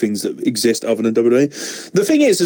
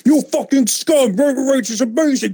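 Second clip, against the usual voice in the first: mock a shrieking online fan; Roman Reigns fan; 12 semitones higher than usual. Mocking angry Twitter fans defending Roman Reigns, high put-on voice. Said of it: "fifty comments on it going".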